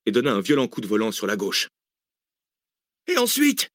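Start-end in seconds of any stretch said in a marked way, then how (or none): 3.1-3.7 very high-pitched yell. A narrator's reading. Cécile asking the driver what comes next, yelling. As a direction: yell the question out, voice shooting up. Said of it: "hurla Cécile".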